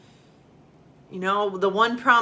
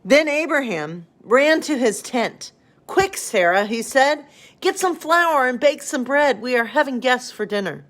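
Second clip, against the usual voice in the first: higher, louder storybook narration; story reading; 6 semitones higher, 7 dB louder than usual. A narrator's reading. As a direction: read it like a children's bedtime story. Reads story with Abraham quote.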